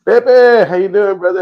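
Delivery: excited greeting. Real, not acted. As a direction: call out his name with big energy. Greeting a viewer on stream.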